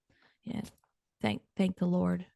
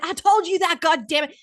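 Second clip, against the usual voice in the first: exasperated scold; 8 semitones higher, 6 dB louder than usual. Retold or imitated. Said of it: "I said".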